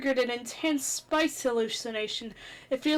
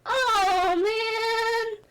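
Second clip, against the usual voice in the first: surprised; 8 semitones higher, 3 dB louder than usual. Real, not acted. Waking from vision.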